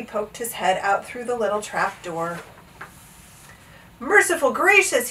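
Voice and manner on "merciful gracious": high startled exclamation; startled gulp. A narrator's reading. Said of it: "he gulped".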